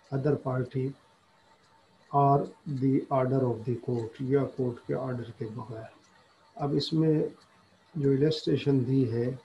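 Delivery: ordinary talk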